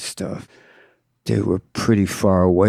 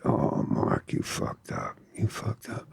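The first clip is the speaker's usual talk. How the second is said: very soft, half-voiced phone warning; hushed mock dismay; 11 dB quieter than usual. Hipster phoning the tattooer with bad news, hushed. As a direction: barely voice it, imitating an ominous caller.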